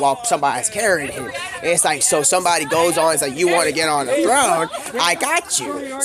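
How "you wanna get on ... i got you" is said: mock offer. Mock offer to carry on throne.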